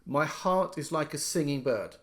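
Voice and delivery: heart lifted and held, the rest light; a teacher marking the beat on heart; stress on heart. Shown as a demonstration. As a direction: hit the word heart at the start of the line. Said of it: "The stress is on heart".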